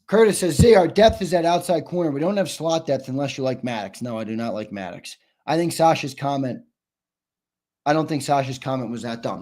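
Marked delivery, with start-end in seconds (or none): none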